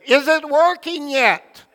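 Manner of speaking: put-on a light Dutch accent, high and loud. Old Dutch man at the car wash asks the driver, in a Dutch accent. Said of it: "in his fine Dutch accent".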